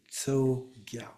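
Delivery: "Tsogyal" slowly, starting on a fused ts; one ts sound opening "Tsogyal"; combined TS. Shown as a demonstration. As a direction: demonstrate "Tsogyal" with T and S joined. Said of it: "It's a combination of the".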